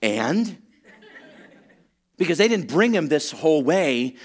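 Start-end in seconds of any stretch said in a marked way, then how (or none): 0.0-0.6 baffled deadpan. Acted. Friends baffled, comic beat.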